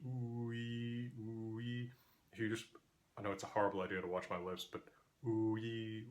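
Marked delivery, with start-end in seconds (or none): 0.1-1.9 OO sliding into EE, each vowel held; vowel glide demo. Shown as a demonstration. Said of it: "notice when you move from oo to E". 5.3-6.0 rounded OO spreading into EE; vowel glide demo. Shown as a demonstration. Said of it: "watch my lips spread when I make E".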